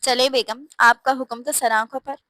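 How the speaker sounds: ordinary delivery, nothing marked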